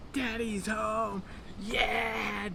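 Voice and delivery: high, drawn-out mock kids' cheer; imitating excited kids. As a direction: cheer like kids greeting their dad. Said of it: "they're just so excited".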